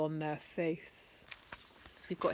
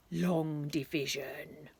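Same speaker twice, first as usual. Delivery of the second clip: dreading drawl; 5 semitones lower, slower than usual. A narrator's reading. Dreaded phrase drawn out.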